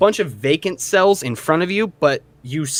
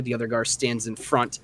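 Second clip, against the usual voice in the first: usual speaking voice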